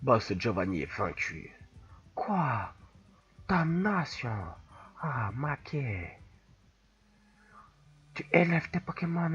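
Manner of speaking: put-on soft Italian-accented villain monologue; put-on Italian accent, quietly menacing. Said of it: "Je fais bien l'italien".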